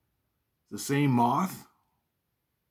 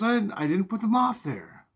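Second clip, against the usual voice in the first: mom denying; 10 semitones higher than usual. Retold or imitated.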